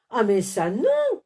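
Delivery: loud, leaping high on non; wolf refusing to marry the yeti; shouted refusal. A narrator's reading. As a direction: cry out a horrified no. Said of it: "cria Lou".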